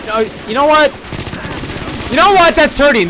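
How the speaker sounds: worked-up protest. Real, not acted. Player getting hurt in game.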